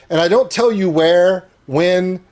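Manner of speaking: ordinary talk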